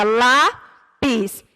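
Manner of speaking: "lápis" bem devagar, com pausa entre "lá" e "pis"; separado em sílabas. Shown as a demonstration. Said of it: "que a gente separa". ("lápis" dito sílaba por sílaba, pausadamente)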